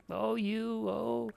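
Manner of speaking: playful mock coy; lightly voiced coy manager; high coy tease. Manager brushing off teasing, high and coy. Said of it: "they're just like".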